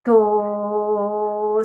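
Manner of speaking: to held long and clear; sounding out a syllable. Shown as a demonstration. Teacher isolates to for pupils to hear the T. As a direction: draw out to so the T stands out.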